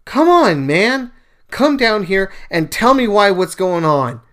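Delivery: loud, high, exasperated cry to heaven; yelling at God. Retold or imitated. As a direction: shout it up at the sky, fed up. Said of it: "I scream at God and go".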